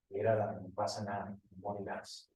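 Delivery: soft, sly whisper of the devil, fading away; devil tempting. Retold or imitated. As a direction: coax her quietly, like a tempter. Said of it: "Y viene el diablo y dice". (el diablo susurrando tentaciones, cada vez más bajo)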